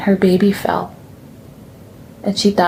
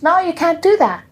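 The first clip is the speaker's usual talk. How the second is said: put-on child refusing; 9 semitones higher than usual.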